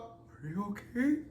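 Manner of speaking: asking while trying not to laugh; concerned question that dissolves into giggles; asking the fallen friend if she's okay, laughing; laughing fake concern. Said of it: "I was like".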